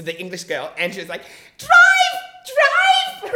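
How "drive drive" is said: imitated panicked scream, very high and loud. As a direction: scream it at the driver, drawn out. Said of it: "She's screaming".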